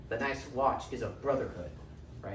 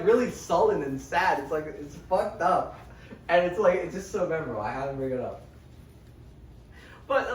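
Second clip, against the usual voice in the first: ordinary talk, nothing marked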